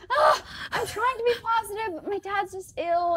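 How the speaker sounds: high-pitched, exasperated retold protest; flustered protest. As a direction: protest helplessly, acting out your past self. Retelling own flustered reply to nurse.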